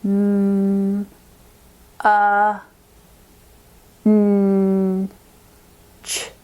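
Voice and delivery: munch as M, a, n, ch with long gaps; munch slowly segmented sound by sound; sounds taken apart. Shown as a demonstration. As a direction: hold M and n, keep a and ch separate. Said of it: "How many sounds do you think".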